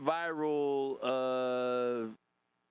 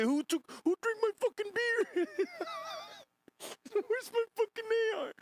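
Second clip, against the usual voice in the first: high squealing impression; mock high yell. Streamer imitating the clip guy, high squeal.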